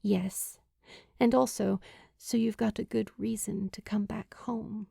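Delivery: breathy whispered confession; whispering so only he hears. Acted. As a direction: let it slip out in a hushed whisper. Julie's tender confession to Luke.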